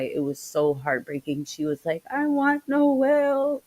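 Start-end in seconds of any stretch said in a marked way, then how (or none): 2.1-3.6 put-on high, slow, tearful child's plea; imitating a crying child. Friend retells the little girl crying for Noel, high and slow. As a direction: mimic a little girl sobbing for her dad. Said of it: "his baby girl was crying her eyes out".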